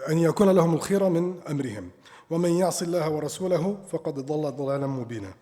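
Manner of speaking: fast rhythmic Quran recitation. Acted. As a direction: recite the verse briskly.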